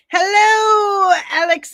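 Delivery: high, loud, drawn-out hello; big shouted greeting. Real, not acted. Host welcoming a viewer to the stream, loud singsong holler.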